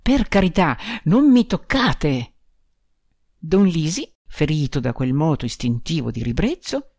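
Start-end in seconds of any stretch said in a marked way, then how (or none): 0.0-2.3 a bride shrinking into the corner, begging not to be touched; frightened cry. A narrator's reading. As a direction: cry it out, recoiling in horror. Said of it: "gli gridò".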